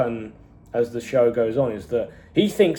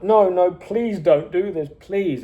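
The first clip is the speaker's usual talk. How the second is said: pleading; 6 semitones higher, slower than usual. Retold or imitated. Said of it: "he's so upset".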